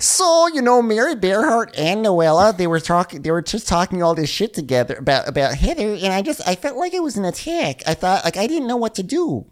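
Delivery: high, exaggerated Michigan voice; hammed-up Michigan complaint; mock Michigan accent. Resumes Nicole impression, high voice.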